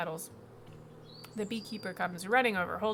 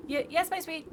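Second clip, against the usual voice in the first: higher, louder nervous stutter; stammering beekeeper; 9 semitones higher, 6 dB louder than usual. Acted. Beekeeper answers his demanding queen, stammering nervously. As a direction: stumble over the words in fear.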